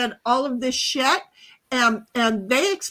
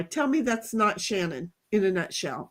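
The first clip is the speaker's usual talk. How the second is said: normal talking voice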